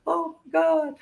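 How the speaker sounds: high squealing fangirl voice, far above own pitch; gushing fans. Retold or imitated. Crowd watching a show, squealing in disbelief. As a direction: squeal it like a starstruck crowd member. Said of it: "watching and like".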